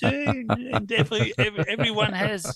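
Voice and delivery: hammed-up French "eh", sing-song and high; French impression. Guest imitating the French way of speaking for the host, in a high, laughing mock French accent. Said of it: "the Français, they have a little bit of like, like this".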